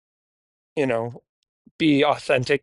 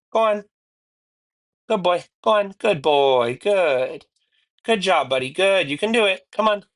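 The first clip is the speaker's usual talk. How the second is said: coaxing praise; 5 semitones higher than usual. Real, not acted. Coaxing dog into crate.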